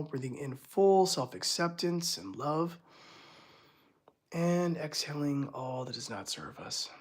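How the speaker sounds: soft meditation voice counting breaths; guided meditation. Acted. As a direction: guide them gently through each breath.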